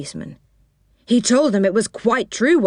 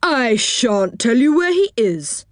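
angry policeman; 5 semitones higher than usual. Acted. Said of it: "said the policeman angrily".

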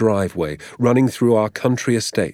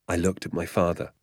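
usual speaking voice

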